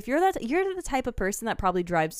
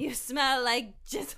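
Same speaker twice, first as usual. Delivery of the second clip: mock disgusted rant; 6 semitones higher, 4 dB quieter, slower than usual. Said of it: "He's so upset".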